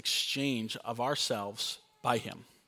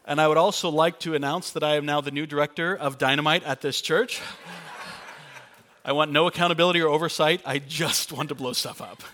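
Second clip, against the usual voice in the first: loud, fast, gleeful proclamation; joking mock announcement; 2 semitones higher, 7 dB louder than usual. Real, not acted. Pastor jokingly crowns himself director of dynamite, loud and gleeful. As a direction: play it like a giddy self-appointed boss.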